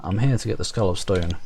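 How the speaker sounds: ordinary talk, nothing marked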